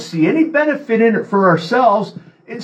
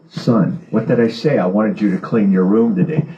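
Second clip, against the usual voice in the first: mother's voice dropped lower, mild and patient; a lightly voiced mother asking her son nicely; gentle mother asking; 13 semitones lower than usual. Mother gently asking her son to clean his room, lower and patient. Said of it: "Then later on".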